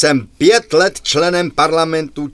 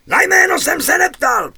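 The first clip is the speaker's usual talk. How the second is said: high, shouted snap at his deputy; angry officer; 13 semitones higher than usual. Acted. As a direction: bark it back, indignant. Officer yells back at deputy.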